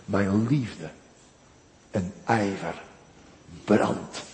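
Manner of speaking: soft, low, slow reverent voice; quiet, intimate preaching; hushed devotion. Acted. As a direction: draw them in close with a hushed tone. Tender hushed preaching on Christ.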